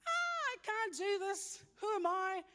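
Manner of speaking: hammed-up wail of self-doubt; panicked freak-out. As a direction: act out Moses freaking out, voice shooting up. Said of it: "Moses freaks out".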